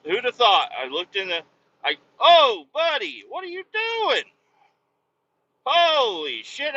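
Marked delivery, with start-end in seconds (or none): 2.2-4.2 much higher, louder and slow; alarmed shout. Retold or imitated. Another car cuts into his lane. 5.6-6.8 higher and slowed, as if right after the near miss; startled exclamation. Real, not acted. Near collision while driving.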